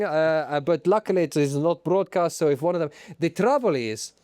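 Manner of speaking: put-on comic Italian accent; Stefano Domenicali. Boss introducing himself to the public, Italian accent. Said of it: "I'm Stefano Domenicali".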